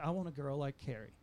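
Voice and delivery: ordinary delivery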